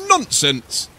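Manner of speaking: loud, curt dismissal; sharp telling-off; scolding. A narrator's reading. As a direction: rebuke them like a mother. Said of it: "Their mother scolded".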